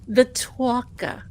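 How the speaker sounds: put-on Boston accent, "talker" said as "tawka" with no r; lightly mocking Boston accent. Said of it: "You love it when I do the Boston accent".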